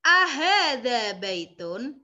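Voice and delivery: "Ahada baitun" slow, rising at the end; Arabic model reading. Shown as a demonstration. Teacher modelling a new question phrase, slow and clear. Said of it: "teacher models Arabic reading".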